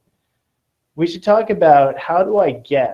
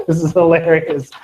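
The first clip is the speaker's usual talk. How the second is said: laughing; 3 semitones higher, 3 dB louder than usual. Real, not acted. Teacher laughing at own joke.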